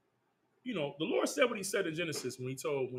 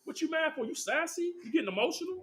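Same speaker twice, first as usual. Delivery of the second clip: high, teasing mock taunt; hammed-up sassy taunt; 10 semitones higher than usual. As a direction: poke fun at the king like a critic. Mocking critics calling king sassy.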